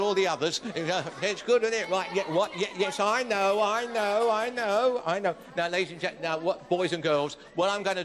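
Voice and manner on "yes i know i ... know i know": ordinary delivery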